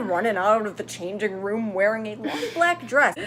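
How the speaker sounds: ordinary delivery, nothing marked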